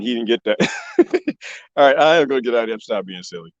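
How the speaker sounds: ordinary delivery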